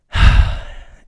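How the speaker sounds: loud, breathy exhale; heavy sigh. Real, not acted. Teller overwhelmed by his own story, heavy sigh. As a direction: let out a big sigh. Storyteller sighs before going on.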